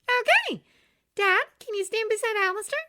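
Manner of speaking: put-on high, bright girl's voice; bubbly young woman; cheerful young woman voice. A narrator's reading.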